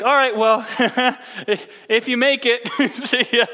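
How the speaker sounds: chuckling through a disbelieving see-ya; amused farewell, laughter breaking in; laughing goodbye. Retold or imitated. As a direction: laugh it off as you walk away. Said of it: "I remember being like".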